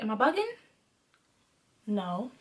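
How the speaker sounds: soft question, low hushed answer; talking to oneself. Retold or imitated. Woman having a conversation with herself, quiet self-talk. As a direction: ask yourself quietly, answer under your breath. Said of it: "I'm having a conversation with myself".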